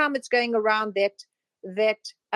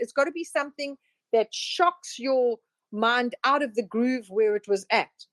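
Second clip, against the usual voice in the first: normal talking voice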